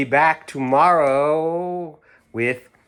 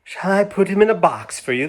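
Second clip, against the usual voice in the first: saleslady; 8 semitones higher, 4 dB louder than usual. Acted. Saleslady voiced in story.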